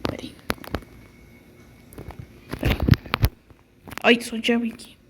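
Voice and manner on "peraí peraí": whispering. Pretend. Creeping in the dark.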